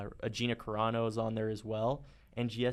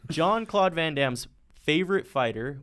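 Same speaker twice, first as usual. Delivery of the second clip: ordinary delivery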